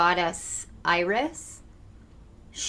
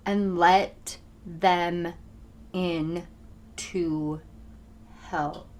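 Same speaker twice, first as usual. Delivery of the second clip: word by word, with long pauses; very slow, emphatic; 4 dB quieter, much slower than usual. Real, not acted. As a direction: draw it out slowly, letting each word land. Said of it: "They wanted to talk really slow there and very much emphasize".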